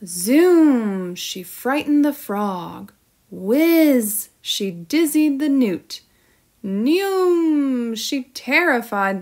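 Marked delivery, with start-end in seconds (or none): 0.0-1.1 loud zoom with falling pitch; zooming sound effect. A narrator's reading. Narrated swoop at the frog. 3.3-4.3 loud, high whiz; whizzing sound effect. A narrator's reading. Narrated swoop at the newt. 6.6-8.0 high, drawn-out zooming noise; flyby sound effect. A narrator's reading. Narrated swoop at the toad.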